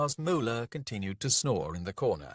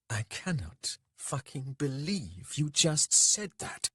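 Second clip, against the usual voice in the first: high, drawn-out incredulous anger; slow fury; 7 semitones higher, slower than usual. A narrator's reading. Trista, blade palmed, confronts Dec, slow fury. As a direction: spell out the outrage slowly, pitched up.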